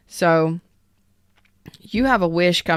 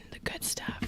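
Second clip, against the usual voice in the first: breathy hush with no voice; whisper; 17 dB quieter than usual. Shown as a demonstration. Reader whispering the good stuff to fans. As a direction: tease it in a secret whisper.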